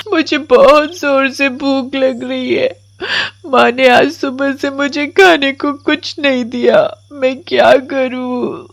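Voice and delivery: put-on high child voice, weepy and plaintive; hungry little girl. Starving Komal pleads to no one, high and tearful. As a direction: whimper out your hunger like a small girl.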